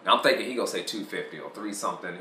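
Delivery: normal talking voice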